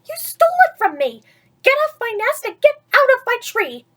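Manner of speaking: high, loud, fast accusation; furious scolding. Acted. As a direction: chase him off her nest. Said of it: "she sputtered".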